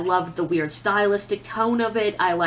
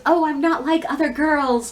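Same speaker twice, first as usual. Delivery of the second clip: higher, louder snooty mock voice; mocking not like other girls; 6 semitones higher, 3 dB louder than usual. Booktuber mocking the not-like-other-girls type, snooty mock voice. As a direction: sneer it out like a smug girl who thinks she's special. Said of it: "I don't wanna sound like one of those".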